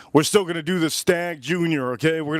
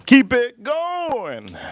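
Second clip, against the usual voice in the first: excited egging on; 8 semitones higher, 4 dB louder, much slower than usual. Real, not acted. Egging on the calling monkey.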